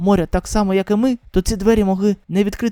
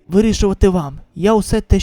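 normal talking voice

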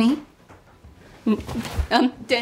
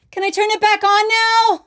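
high, loud call from afar; from another room; 13 semitones higher, 8 dB louder than usual. Acted. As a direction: shout up from the basement. Calling from the basement.

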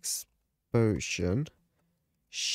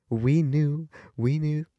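gloating; 5 semitones higher, 5 dB louder than usual. Real, not acted. Gloats after the vote.

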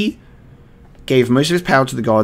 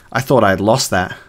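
ordinary talk, nothing marked

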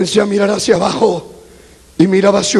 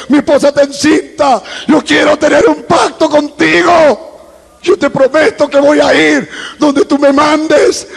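high-pitched plea building to a shout; desperate begging; 7 semitones higher than usual. Retold or imitated. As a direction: beg God with mounting force, ending at full cry. Súplica creciente a Dios. (súplica desesperada que crece hasta el grito)

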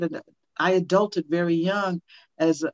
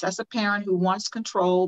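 usual speaking voice

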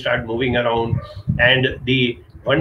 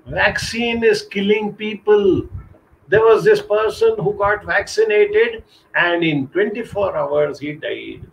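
alarmist rumour-monger; 7 semitones higher than usual. Retold or imitated. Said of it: "somebody says".

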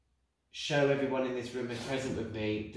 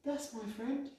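much higher and softer, distant; spirit's voice; 11 semitones higher, 6 dB quieter, slower than usual. Retold or imitated. Medium channelling the spirit's words, high and soft. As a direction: let the spirit speak through you, soft.

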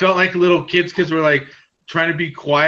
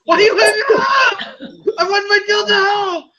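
much higher, louder squawk; mock outraged caller; 3 dB louder than usual. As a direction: shriek it like the angry customer. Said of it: "call them back and say".

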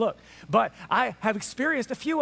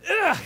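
mock-frustrated ugh; exasperated groan; 9 semitones higher, 7 dB louder than usual. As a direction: groan in playful disbelief. Jesus exasperated with his disciples.

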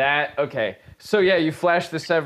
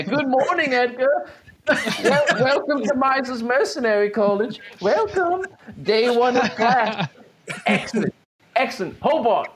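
mock cheerful professor; 8 semitones higher than usual. GM mock teacher greeting.

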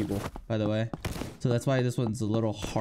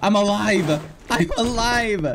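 high, much louder triumphant cries; elated shouting; 9 semitones higher, 8 dB louder, slower than usual. Real, not acted. Gamer shocked to survive, elated loud shouts. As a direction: shout your joy at surviving.

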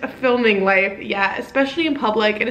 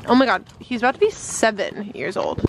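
normal talking voice

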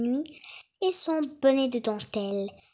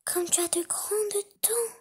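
put-on little girl voice; 9 semitones higher, 4 dB quieter than usual. A narrator's reading. Girl to disguised wolf.

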